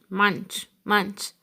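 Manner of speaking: ordinary delivery, nothing marked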